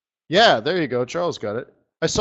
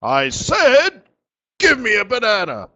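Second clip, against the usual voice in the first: loud, higher, pushy rooster insisting; insistent rooster; 6 semitones higher, 6 dB louder than usual. Acted. Rooster repeating his order to the bartender, loud and insistent. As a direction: slam the demand down again.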